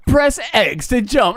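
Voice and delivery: high, drawn-out announcer-like prompt; game prompt voice. Acted. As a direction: call it out slowly like a game tutorial. Acting out the game's on-screen prompt.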